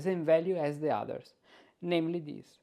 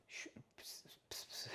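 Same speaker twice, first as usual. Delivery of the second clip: whispering; 23 dB quieter than usual. Real, not acted.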